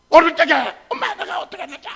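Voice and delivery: high, strained yell bursting out; enraged outburst. Acted. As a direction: explode at mother with long-held resentment. Acting a son exploding at mother. (엄마에게 폭발하듯 높게 고함치기)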